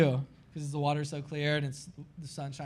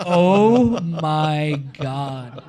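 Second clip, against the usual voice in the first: very drawn out and louder oh my God; stunned awe; 8 dB louder, much slower than usual. Retold or imitated. Surfer dropping into his biggest wave, drawn-out awe. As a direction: hang on every word in disbelief.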